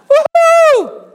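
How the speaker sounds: high, loud whoop of joy; joyful whoop. Real, not acted. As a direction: let out a celebrating woo-hoo. Jubilant cry.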